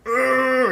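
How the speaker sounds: high, loud effort groan; mimicked straining groan. Trying to lift car off coworker's arm, acted strain. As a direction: act out heaving something too heavy.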